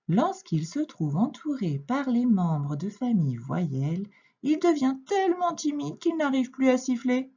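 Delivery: usual speaking voice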